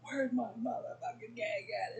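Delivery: playfully imitating a sweet girl acting tough; cute girl's tough line. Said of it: "cutest one in the world says".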